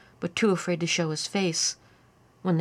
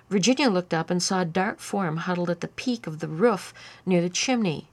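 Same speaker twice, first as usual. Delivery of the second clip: ordinary delivery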